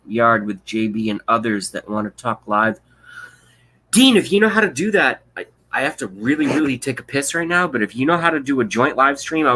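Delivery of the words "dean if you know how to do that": calling out. Real, not acted. Calling out to a chatter.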